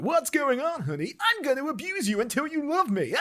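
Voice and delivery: high, hammed-up theatrical voice; squealed mock line ending in a cackle; stage actor. Actor performing a comedy play, high hammy delivery with laughter. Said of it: "Taming of the Shrew comes on".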